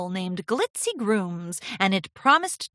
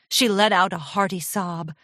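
ordinary delivery, nothing marked

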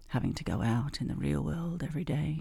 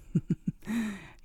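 amused giggle; 7 semitones higher than usual. Acted. Teasing hypnosis roleplay.

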